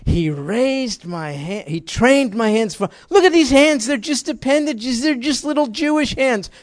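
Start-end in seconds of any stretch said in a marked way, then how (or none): 1.7-6.5 much higher and louder, mocking the little hands; high loud preaching. Preacher to the church about David's small hands, high and loud.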